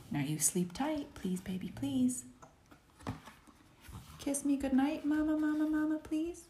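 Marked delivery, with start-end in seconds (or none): none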